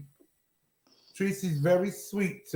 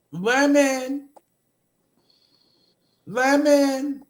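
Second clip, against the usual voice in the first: drawn-out, raised call of a name; 4 semitones higher, slower than usual. Real, not acted. Host to the silent Lemon, calling out. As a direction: summon someone who isn't answering.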